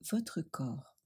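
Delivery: ordinary delivery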